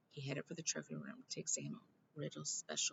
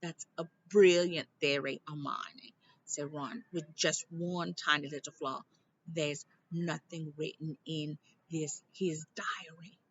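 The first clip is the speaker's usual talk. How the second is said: sarcastic Ron; 8 dB louder, slower than usual. Acted. Ron sarcastic about her theory.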